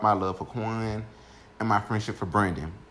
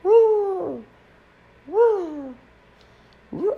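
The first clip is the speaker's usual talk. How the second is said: high, sustained ringing "woo"; long piercing tone like ringing ears; imitated loud ringing; 5 dB louder, much slower than usual. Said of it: "it's like a loud ring".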